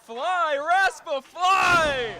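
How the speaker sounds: yelled cheer at full voice; shouting. Real, not acted. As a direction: roar him on from ringside. Cheering on a wrestler.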